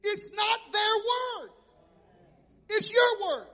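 real preacher's shout; preaching shout. Real, not acted. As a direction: drive it home to the crowd. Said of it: "You didn't hear me".

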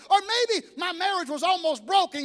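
preacher climbing to a triumphant shout; preaching at full force. Acted. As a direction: build the testimony to a roar. Preaching builds to climax.

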